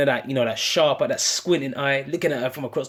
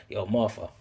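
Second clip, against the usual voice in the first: calming her down; 6 semitones lower than usual. Shown as a demonstration. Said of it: "you gotta say their name not just once but twice".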